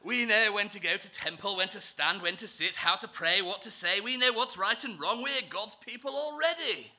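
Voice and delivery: loud, posh, self-important English; posh accent. Acted. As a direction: recite your rules like a proud posh snob. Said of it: "this is as posh as I get".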